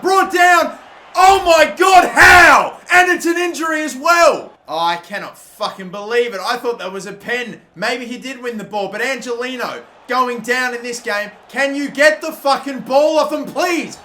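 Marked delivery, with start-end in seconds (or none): 0.0-4.5 high, very loud outraged yelling; furious shout. Real, not acted. Foul and injury in game. 11.5-14.0 louder, higher exasperated yell; angry demand. Real, not acted. Yelling at his player.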